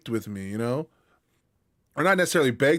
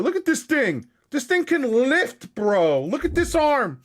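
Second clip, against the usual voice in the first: much higher, excited gushing over the dog; high mock-awed gushing; high excited squeal; 11 semitones higher than usual. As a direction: squeal about the dog's arms. Voicing the buff dog.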